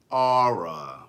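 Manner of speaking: letter R as two-syllable "Aura", DMV style; DMV accent. Shown as a demonstration. Host spelling R for DMV people, in their accent. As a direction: stretch R into a low "Aura" like DMV locals. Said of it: "for the people in D M V".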